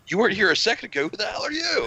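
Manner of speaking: high, fast, puzzled voicing of the dog; dog's confused voice. Voicing the confused dog Oliver, high and quick. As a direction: give the dog a high, baffled cartoon voice that keeps rising.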